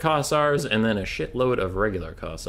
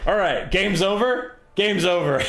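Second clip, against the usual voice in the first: excited; 7 semitones higher, 4 dB louder than usual. Real, not acted. Game ends, loud high.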